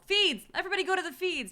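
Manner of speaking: loud, high mock rallying cry; crowd shout. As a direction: yell it like the whole fandom shouting. Internet fans rallying to the feeds.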